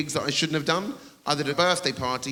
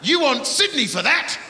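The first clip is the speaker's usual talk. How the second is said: heckler at the back; 13 semitones higher, slower than usual. Retold or imitated. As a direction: yell it from the back of the room. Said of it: "A little voice at the back went".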